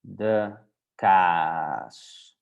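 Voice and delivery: kaas with the aa stretched out very long; overdone long A. Shown as a demonstration. Teacher overdoes it for beginners, long drawn-out kaas. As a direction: drag out the vowel in kaas, slowly, as a model. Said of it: "you can overdo it".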